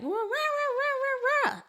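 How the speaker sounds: high mock kid whine; high whiny kid protest; kids whining. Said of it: "my kids are like".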